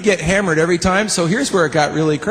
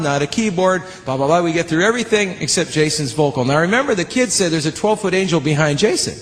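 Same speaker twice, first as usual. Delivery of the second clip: normal talking voice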